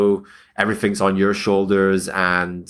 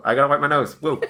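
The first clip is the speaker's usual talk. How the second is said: ordinary delivery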